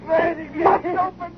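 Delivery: high, crying plea as the way home vanishes; desperate high-pitched dread, near tears; panicked despair. Acted. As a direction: voice cracking with fear over being stranded. Realizes they are stranded forever.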